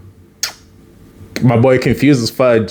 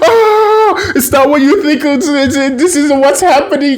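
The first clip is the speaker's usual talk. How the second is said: high, yelping cry of being caught; high-pitched, hammed-up alarm; mock panic; 13 semitones higher than usual. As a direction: blurt excuses in a put-on panic. Caught kissing, both panic.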